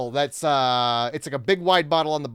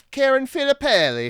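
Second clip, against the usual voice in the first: higher, sing-song Italian accent on Karen Filippelli; 9 semitones higher, 3 dB louder than usual. Shown as a demonstration. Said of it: "when I do a, an Italian accent".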